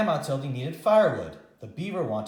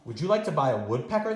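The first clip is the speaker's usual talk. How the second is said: fast, eager sales pitch; quick salesman; 2 semitones higher, 3 dB louder, faster than usual. Acted. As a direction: rattle it off like a peddler. Pet man's sales pitch.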